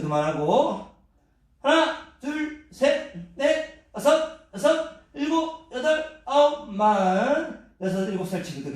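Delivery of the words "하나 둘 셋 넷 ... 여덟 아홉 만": shouted count while exercising. Acted. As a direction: count it out mid-workout.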